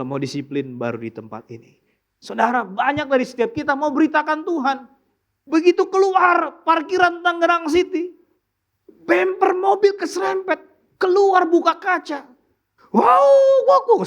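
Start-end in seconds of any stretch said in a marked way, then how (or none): 5.4-14.1 high, loud mock outburst; mock angry driver. Preacher mocking an angry driver after a bumper scrape, loud and high. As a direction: ham up a driver blowing up over a scrape.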